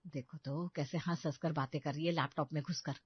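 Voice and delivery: low, soft resentful muttering; grumbling mutter. A narrator's reading. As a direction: grumble to yourself under your breath. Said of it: "क्यों बड़बड़ा रही हो विनीता".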